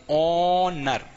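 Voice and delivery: ऑनर with a long opening "o" and silent h; drawn-out pronunciation. Shown as a demonstration. Teacher shows how this word is read, ऑनर drawn out without h. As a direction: stretch the first vowel of ऑनर, no h. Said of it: "इसको क्या पढ़ते हैं".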